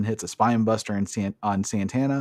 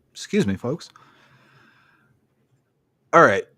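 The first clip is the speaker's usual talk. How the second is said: hammed-up ring announcer, higher and louder; mock announcer; 3 semitones higher, 4 dB louder than usual. Host on the podcast announcing the Piper's Pit segment, mock announcer voice. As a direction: introduce the next segment like a showman.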